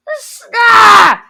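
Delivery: high, loud exasperated "ah"; loud, high groan of exasperation; frustrated yell. Real, not acted. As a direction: burst out in a frustrated cry. Venting frustration at the teacher.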